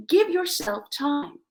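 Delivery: normal talking voice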